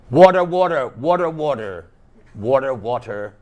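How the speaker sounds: a thirsty New Yorker calling for water; New York accent. Shown as a demonstration. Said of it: "You see my accent?".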